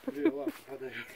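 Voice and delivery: high playful dog-talk. Real, not acted. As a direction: tease the pet in a high voice. Owner teases dog.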